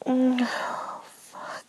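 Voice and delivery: usual speaking voice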